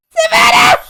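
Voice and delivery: high, loud shout of the name; calling out. Real, not acted. Streamer yelling at Savannah for spamming, loud call. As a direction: yell the name across the room.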